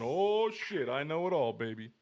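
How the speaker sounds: high, loud smug boast; cocky know-it-all. Retold or imitated. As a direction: brag like you just got your first save. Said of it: "all of a sudden it's fucking".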